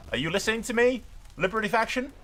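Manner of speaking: high mocking taunt at the rebels. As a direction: needle them, sing-song menace.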